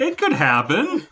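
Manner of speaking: hammed-up self-defence; mock hurt protest. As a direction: insist on it, playfully wounded. Joking as offended Warner.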